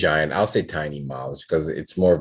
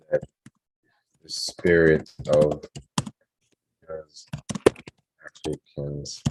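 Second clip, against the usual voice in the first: word-by-word story composing, long pauses; slow dictation; 3 semitones lower, 4 dB quieter, slower than usual. Real, not acted. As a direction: dictate it haltingly, as if writing along.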